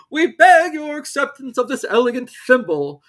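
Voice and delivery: high, loud ceremonial speech; solemn dodo. Acted. Dodo presenting the thimble, loud and solemn. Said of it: "solemnly presented the thimble".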